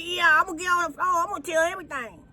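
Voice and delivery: put-on high, quick child-like retelling; excited kid quote. Mimicking his seven-year-old stepson bursting to tell all. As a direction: voice the little boy eagerly spilling everything.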